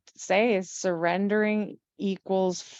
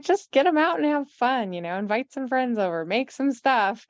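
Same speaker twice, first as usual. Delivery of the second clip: higher-pitched imitation of an upbeat husband; imitated encouraging husband; 7 semitones higher than usual. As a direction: lightly voice a husband cheering her on. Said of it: "he's like".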